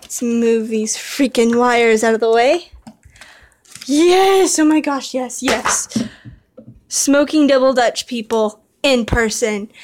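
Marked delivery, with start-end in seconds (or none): 3.9-6.0 thrilled. Real, not acted. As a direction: burst out in triumph. Finally frees the model horse.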